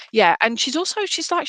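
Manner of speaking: ordinary talk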